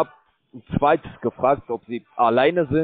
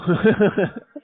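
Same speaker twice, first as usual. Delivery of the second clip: kurzes hohes Auflachen; hohes Lachen; 9 semitones higher than usual. Real, not acted. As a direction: lacht hell auf. Laughs at not knowing. (hell auflachen)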